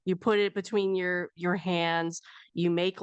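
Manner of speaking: usual speaking voice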